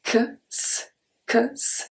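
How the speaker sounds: "ks" said softly as k then s; ks sound. Shown as a demonstration. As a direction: breathe out "ks", k gliding into s. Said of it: "it's going to make the sound".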